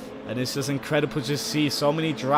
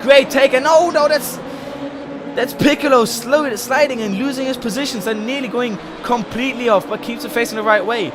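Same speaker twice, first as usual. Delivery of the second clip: raised, quick live race commentary; motorsport commentator; 8 semitones higher, 6 dB louder than usual. Real, not acted. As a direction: call the race as it happens.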